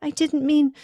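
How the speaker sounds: high, faltering apology; anguished, sorry stammer; remorseful. Acted. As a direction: plead for forgiveness. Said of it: "all remorse".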